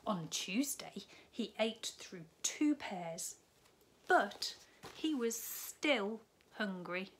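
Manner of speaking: lively storybook read-aloud; bright children's story narration. A narrator's reading. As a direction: tell it like a bedtime story to little ones. Reads picture book to children.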